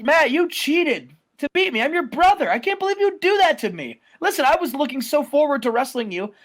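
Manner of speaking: a wounded younger brother complaining; whiny Jeff. Acted.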